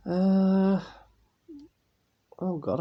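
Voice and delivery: ordinary talk, nothing marked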